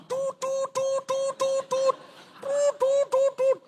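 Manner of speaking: high-pitched "toot toot" noise; piercing imitated beeps. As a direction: mimic a run of high toots. Imitating a repeated tooting noise.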